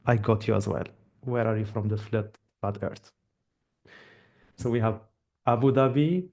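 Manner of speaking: ordinary talk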